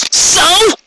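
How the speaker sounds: very high, challenging "So?"; defiant Rainbow. Acted. Rainbow challenges the captain's reasoning, high and defiant.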